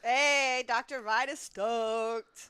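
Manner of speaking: loud, drawn-out hype shout; excited cheer. Real, not acted. Co-host cheering on Doctor Ride, loud excited cheer. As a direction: cheer it out loud, stretching the words. Cheering for a racer who passed.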